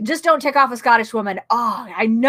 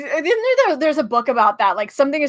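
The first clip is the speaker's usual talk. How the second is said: ordinary talk, nothing marked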